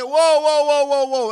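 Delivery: loud, high warning whoas; halting someone with loud whoas; mock loud whoa. Stopping the man in the store.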